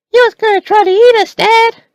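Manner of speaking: put-on very high, loud, fast kid's voice; child's voice. Boy telling his dad the man was a walker, high fast kid voice.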